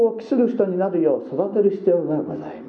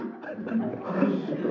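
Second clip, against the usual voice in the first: soft laugh; 6 semitones higher, 7 dB quieter than usual. Real, not acted. Laugh after quote.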